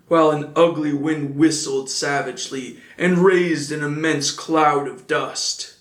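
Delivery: ordinary delivery, nothing marked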